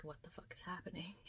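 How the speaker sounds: soft unvoiced whisper of a bewildered dog; laughing whisper; whispered dog thought. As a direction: breathe it as a stunned dog. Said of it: "he was like".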